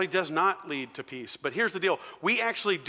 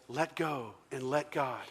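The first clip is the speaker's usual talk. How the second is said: mock English accent; 7 dB quieter, slower than usual. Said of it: "You could learn an English accent".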